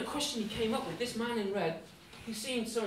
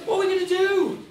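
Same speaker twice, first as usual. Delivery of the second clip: loud, high wail; acted panic; 10 semitones higher, 12 dB louder than usual. Panicking over devil pact.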